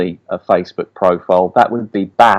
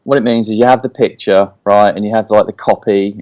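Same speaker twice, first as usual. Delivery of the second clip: usual speaking voice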